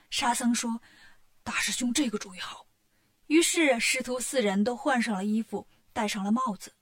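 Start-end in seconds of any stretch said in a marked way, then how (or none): 1.4-2.7 soft unvoiced approval; whisper. A narrator's reading. Sha Seng agreeing with his elder brother, whispered. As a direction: murmur agreement without voice.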